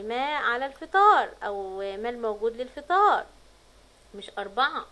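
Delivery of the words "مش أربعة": ordinary talk, nothing marked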